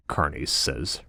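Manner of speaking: ordinary delivery, nothing marked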